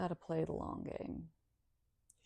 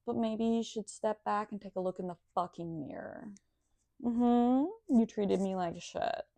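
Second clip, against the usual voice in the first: louder, scornful told-you-so rant; imagined comeback, loud and scornful; 3 semitones higher, 6 dB louder than usual. Pretend. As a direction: let them have it, sneering and smug. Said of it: "I'll be like".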